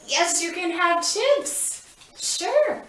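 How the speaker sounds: high, slow, delighted praise; excited praise. Acted. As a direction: reward him with big enthusiasm. Therapist rewarding child's request.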